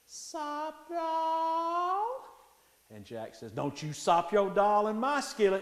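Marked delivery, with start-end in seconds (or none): 0.1-2.2 drawn-out high cat yowl; talking cat. Acted. Cat eyeing Jack's meat in the skillet, high yowling call. 3.5-5.6 raised, louder voice climbing in pitch; stern warning. Acted. Jack warns the cat away from his skillet, loud scolding.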